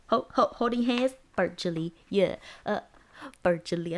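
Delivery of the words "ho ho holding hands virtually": playful stutter. Pretend. Playing with the word virtually.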